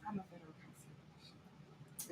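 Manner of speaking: soft, breathy whisper for the women; sultry whisper. Shown as a demonstration. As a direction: whisper it flirtatiously, like you're about to wink. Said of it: "But for the women".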